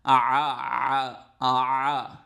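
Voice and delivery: long open-throat aah sound, drawn out slowly like at the dentist; dentist's open aah. Shown as a demonstration. Teacher demonstrating the dentist's آ sound to students, drawn out. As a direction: stretch آ wide and slow from the throat. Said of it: "یہ بالکل وہی آ کی آواز ہے".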